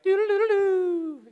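high, drawn-out western showdown riff; imitated gunfighter music. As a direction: mimic a Western standoff theme by voice. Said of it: "gunfighter music".

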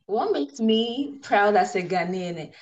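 ordinary talk, nothing marked

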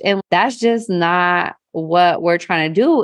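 usual speaking voice

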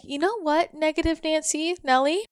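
high, playful call-out; teasing fear. Retold or imitated. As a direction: tease the inner critic lightly.

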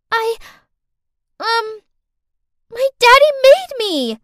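put-on very high little girl voice, halting then loud; hesitate, then wail the excuse; cornered child confessing. A narrator's reading.

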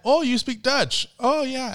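tag. Retold or imitated. Acting out a Friends scene.

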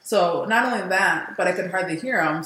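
ordinary talk, nothing marked

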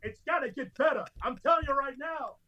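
crank the on-air voice all the way up; over-the-top radio host. Retold or imitated. Host mocking his rival by shouting his own show, laughing. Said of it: "like, like".

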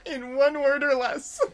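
laughing. Real, not acted. Laughing tease.

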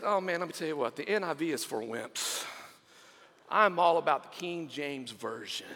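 low dismissive scoff. Acted. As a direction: brush it off with disdain. Man on rail scoffing at NIV.

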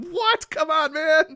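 very high, loud squeal of disbelief; outraged announcer. Retold or imitated. Friend imitating the Vikings announcer's shrieking outrage. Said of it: "But he's just like".

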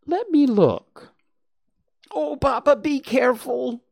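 pleading. Acted. Gwen pleads with Papa.

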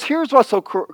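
ordinary talk, nothing marked

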